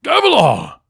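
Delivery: loud, high shout of a name. A narrator's reading. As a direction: call him urgently across the room. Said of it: "Sadeas shouted".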